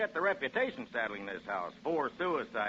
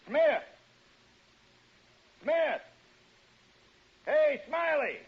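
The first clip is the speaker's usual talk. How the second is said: loud, high shouts to someone inside the house; calling out; 5 dB louder, much slower than usual. Acted. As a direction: call their names across the yard.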